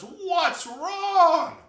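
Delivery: very loud, high bellow; booming storybook lion shout; roaring lion. A narrator's reading. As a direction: demand it with a lion's roar. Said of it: "this lion roars and says".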